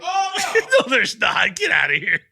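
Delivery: loud laughing denial; laughing shout. Real, not acted. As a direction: yell it through laughter. Laughing denial of something in shoe.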